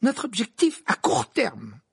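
slow, high-pitched insistence. A narrator's reading. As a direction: talk over him and pretend not to hear. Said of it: "d'une voix encore plus forte". (d'une voix forte, imperturbable)

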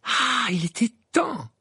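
high, slow, emphatic; relieved exclamation. A narrator's reading. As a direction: exclaim at something long awaited. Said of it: "s'exclama Harry". (exclamation soulagée, voix haute)

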